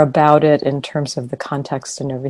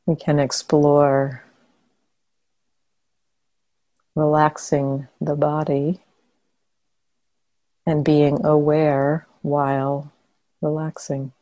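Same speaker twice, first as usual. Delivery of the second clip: calm meditation guidance with long pauses between phrases; guided meditation; 4 dB quieter than usual. Real, not acted. Teacher guiding meditators to soften and relax the body, guided meditation. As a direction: guide them gently into relaxation, leaving long silences.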